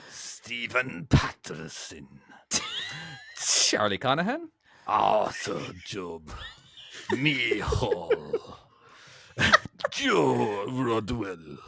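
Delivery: slow, drawn-out names in a put-on accent; slow mock Russian-German accent reading names; worsening mock accent. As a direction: stretch each name in a failing foreign accent. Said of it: "It gets worse the more I go".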